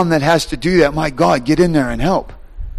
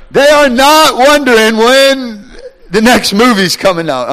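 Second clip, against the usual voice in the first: laughing punchline; 9 semitones higher, 5 dB louder than usual. Acted. Preacher's punchline.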